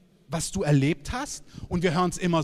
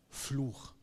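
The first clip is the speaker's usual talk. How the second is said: solemn verdict; 5 semitones lower, 10 dB quieter than usual. Retold or imitated. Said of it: "aber Gott sagt".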